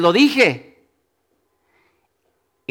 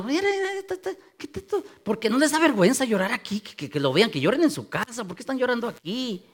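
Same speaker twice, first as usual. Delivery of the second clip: mock scandalized gossip; 3 semitones higher, 7 dB quieter, faster than usual. Preacher mimics a newcomer judging weepers, hushed mock scandal. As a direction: imitate a busybody tutting under the breath.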